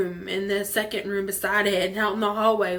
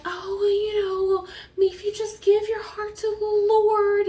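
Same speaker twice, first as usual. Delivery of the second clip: mock-sweet churchgoer voice; sweet church lady; 12 semitones higher, 3 dB louder than usual. Church lady voice, high, slow.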